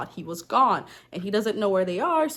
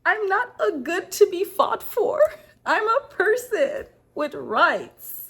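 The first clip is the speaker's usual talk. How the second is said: defiant daughter; 11 semitones higher, 4 dB louder, slower than usual. Acted. Daughter defies her angry father.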